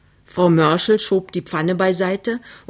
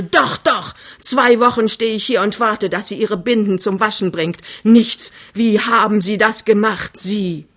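higher, louder angry outburst; shouted accusation; 4 semitones higher, 4 dB louder than usual. Acted. Mother accuses suitor over pregnancy, shouting angrily. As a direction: hurl the accusations at him. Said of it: "schreit Frau Mörschel".

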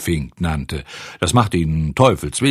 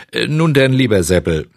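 hesitant loud start; 4 semitones higher, 6 dB louder than usual. Acted. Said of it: "fing der Schreiner an".

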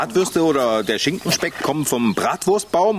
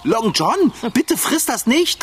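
urgent plea; 7 semitones higher, slower than usual. Acted. Pleading with cat not to eat.

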